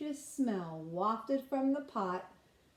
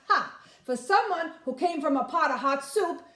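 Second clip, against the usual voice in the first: put-on cocky boy; 5 semitones higher, 6 dB louder than usual. Boy boasts to the fox.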